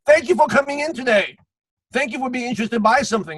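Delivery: over-keen shop greeting, starting high; mock salesman. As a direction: gush like an eager salesman. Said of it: "and the salesman".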